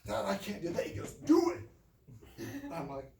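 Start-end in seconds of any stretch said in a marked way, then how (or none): 0.0-0.8 put-on high, loud kid's plea; acting out a scared child protesting; panicky protest. Ten-year-old begging her dad not to make her face a bull, panicky. 1.1-1.6 very high, loud shouted command; barked order. Retold or imitated. Dad ordering his kid to face a bull, shouting.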